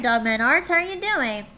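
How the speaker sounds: ordinary delivery, nothing marked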